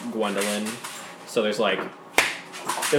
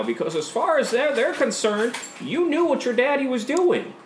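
much higher, mocking official voice; Brendan; 11 semitones higher than usual. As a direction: talk down to her, sing-song and smug. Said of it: "He's like".

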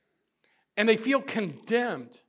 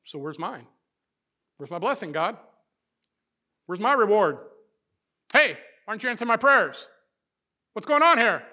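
entitled complaint; 2 semitones higher, 6 dB louder than usual. Retold or imitated. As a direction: act the believer demanding a payout from God. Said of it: "it's kinda like".

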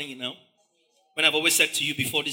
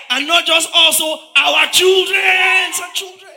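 much higher, loud, slow shout; mob shouting; 10 semitones higher, 8 dB louder, slower than usual. Acted. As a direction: roar it as the crowd. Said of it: "You're shouting".

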